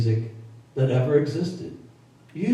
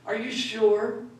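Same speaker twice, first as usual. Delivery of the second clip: put-on higher, slower childlike disbelief; slow stunned kid; 7 semitones higher, 4 dB quieter, slower than usual. A boy asking his dad about [name], slow and high. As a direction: stretch it out like a shocked little kid. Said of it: "I was a kid".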